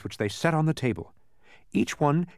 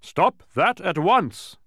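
slow, loud royal order; thundering king; 3 semitones higher, 6 dB louder, slower than usual. A narrator's reading. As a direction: thunder each word at the brawlers. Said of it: "thundered Azaz".

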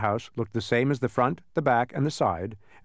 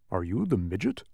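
slightly lowered, mildly unsure question; hint of uncertainty; 2 semitones lower than usual. A narrator's reading. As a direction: ask it with a touch of doubt. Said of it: "with a hint of uncertainty in his voice".

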